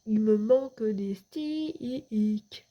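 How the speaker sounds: very slow, drawn-out meme line; meme delivery. Shown as a demonstration. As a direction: stretch it out like a meme catchphrase. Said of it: "C'était mon petit mème". (réplique de mème traînée, très lente)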